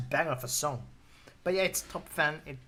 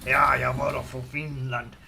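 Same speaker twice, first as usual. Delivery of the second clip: put-on loud, gruff Finland Swedish accent, slowing down; mock-angry Finland Swedish accent; 4 dB louder, slower than usual. Imitating a Finnish man speaking Swedish, Finland Swedish accent. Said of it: "It sounds angry, but it's not angry".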